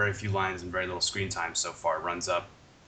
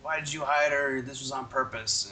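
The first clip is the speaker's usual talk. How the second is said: higher-pitched mock accusation; retold yelling; 7 semitones higher than usual. Host retells Shane confronting the others, mock yelling. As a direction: imitate an accusing rant, pitched up. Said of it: "Shane is still running around and yelling".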